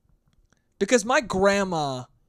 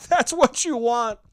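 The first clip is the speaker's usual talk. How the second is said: laughing; 5 semitones higher, 4 dB louder than usual. Real, not acted. Laughing sarcastic punchline.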